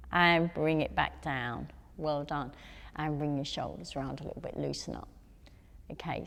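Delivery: quiet, gentle wind-down praise; soft cool-down voice. Real, not acted. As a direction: ease them down softly. Winding down after arm stretches.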